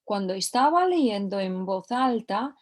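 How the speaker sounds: ordinary talk, nothing marked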